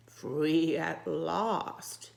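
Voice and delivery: very slow, strange monster voice; sea monster voice. Acted. Reading to a child, voicing the sea monster slowly. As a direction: draw it out like a freed monster.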